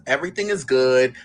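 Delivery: ordinary delivery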